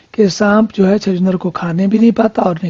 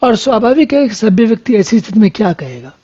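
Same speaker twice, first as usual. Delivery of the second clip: ordinary talk, nothing marked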